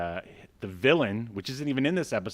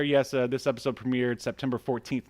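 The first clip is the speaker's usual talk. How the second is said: ordinary talk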